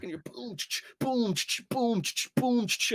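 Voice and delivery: high mouth-made boom and pump clicks; shotgun sounds. Retold or imitated. Kid blasting enemies in dark room, mouth shotgun noises. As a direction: fire and rack the shotgun with your mouth. Imitating shotgun firing and pumping.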